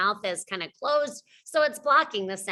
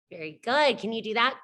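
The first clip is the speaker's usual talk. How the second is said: normal talking voice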